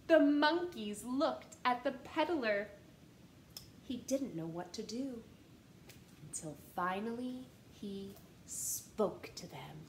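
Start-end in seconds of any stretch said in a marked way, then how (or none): 3.8-9.8 soft hushed narration; storyteller hush. A narrator's reading. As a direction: lower the voice to build suspense.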